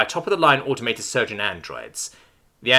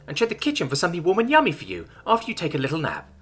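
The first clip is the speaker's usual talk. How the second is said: high, sweet caring robot voice; a chirpy medical android, overly nice; polite android; 9 semitones higher than usual. Acted. Android greeting Chuck in med bay, high sugary caring voice.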